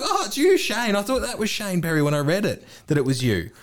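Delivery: high, excited mock girl voice with laughter; surprised girl on the phone. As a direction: squeal it like an old schoolmate. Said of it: "She's like".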